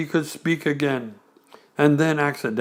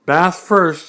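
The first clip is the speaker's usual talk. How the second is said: cheerful; 4 semitones higher, 5 dB louder than usual. Acted. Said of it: "in a cheerful voice".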